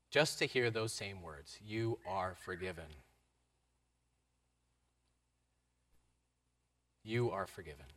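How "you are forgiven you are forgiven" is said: gentle. Acted. Gentle repeated absolution.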